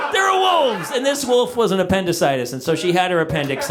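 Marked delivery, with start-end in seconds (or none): 0.1-0.9 high, shouted repeat; shouted warning. Acted. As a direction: yell it the second time, top of the voice.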